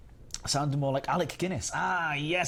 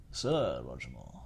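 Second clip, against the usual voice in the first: much lower, slow suave voice; Roger Moore; 10 semitones lower, slower than usual. Retold or imitated. Streamer nails Roger Moore, low and slow. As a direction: purr it slowly like Bond. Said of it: "That's it".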